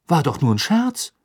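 baffled. A narrator's reading. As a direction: blurt it out, taken aback. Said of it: "sagte er verdutzt".

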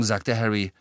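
ordinary delivery

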